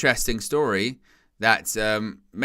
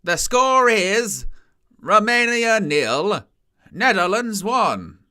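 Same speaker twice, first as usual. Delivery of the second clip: very slow, higher, drawn-out announcer call; stadium announcer; 7 semitones higher, slower than usual. Retold or imitated. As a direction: stretch every word like a stadium PA. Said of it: "stadium announcers are a bit much".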